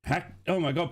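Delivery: usual speaking voice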